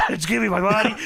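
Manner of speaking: crying-shout begging for money; whiny greedy plea. Retold or imitated. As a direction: beg loudly like a spoiled heel. Greedy wrestler voiced, crying shout.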